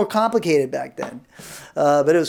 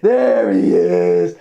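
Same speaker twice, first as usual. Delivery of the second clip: much lower, slow drawn greeting; deep slow; 12 semitones lower, 4 dB louder, much slower than usual. Retold or imitated. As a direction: drop the voice on There he is.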